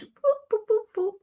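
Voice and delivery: high little boop noises while rearranging; playful boops. Pretend. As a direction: chirp tiny high beeps like a toy. Moving screens around, sound effect.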